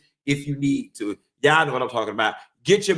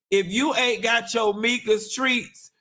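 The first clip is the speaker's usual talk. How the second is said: ordinary delivery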